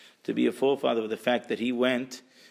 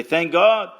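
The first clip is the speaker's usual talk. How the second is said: lifted, louder cry of "Thank God"; raised grateful exclamation; exclaimed thanks; 6 semitones higher, 9 dB louder than usual. Retold or imitated. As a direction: call it out gratefully.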